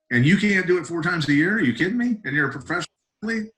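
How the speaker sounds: can you believe it, scoff at them; incredulous rant. Real, not acted. Fighter ranting at pros who miss weight, incredulous.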